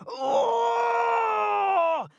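high, loud cry of pain; pained giant howl. A narrator's reading. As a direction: yelp as the tooth breaks. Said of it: "the giant hollered".

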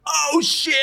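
high shouted background ad-lib. Retold or imitated. Fan mimicking the ad-lib always in the back, high shout. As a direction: imitate a hype shout from the back. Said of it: "It's like".

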